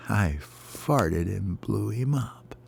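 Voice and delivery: slow, soft, near-whispered punchline; hushed little-bird admission; sheepish confession. Acted. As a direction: let it out quietly and drawn out.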